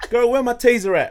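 put-on high and loud sassy girl; sassy girl yelling. Girl at the party shouting for a taser, high and loud. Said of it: "She was like".

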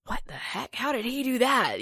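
voicing a baffled crowd, pitch jumping up; mock-astonished reaction on behalf of onlookers; imitated amazement. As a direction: play everybody's disbelief, rising high. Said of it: "Everybody's like".

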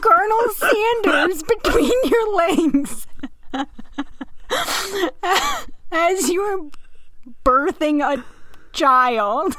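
high, slowed words squeezed through helpless laughter; laughing hard. Real, not acted. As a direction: crack up at the picture of the colonel. Imagining him delivering her baby.

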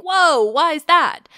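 drawn-out, high, loud mock surprise; mock surprised whoa. Imagined reader reacting to Greek names, with loud drawn-out surprise. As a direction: act out a reader's puzzled surprise, slowly. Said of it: "you might think".

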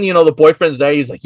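ordinary talk

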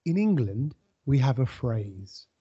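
a hint of a wary East End pensioner; lightly voiced old English neighbour; old Englishman. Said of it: "he goes".

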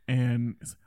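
ordinary talk, nothing marked

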